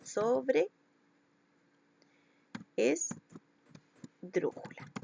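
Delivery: "sobreesdrújula" very slowly, in pieces: "sobre, es, drújula"; dictating while writing. Real, not acted. Teacher writing the word out, "sobreesdrújula" broken into slow chunks. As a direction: pause long between chunks of "sobreesdrújula".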